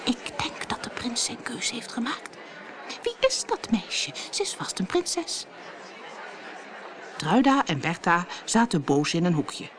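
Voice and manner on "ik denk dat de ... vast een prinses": hushed, high-pitched gossip; gossiping whisper. A narrator's reading. Ballroom women gossiping behind their fans, whispering. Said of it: "fluisterden achter hun waaiers".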